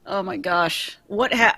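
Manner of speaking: normal talking voice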